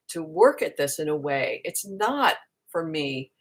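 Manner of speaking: usual speaking voice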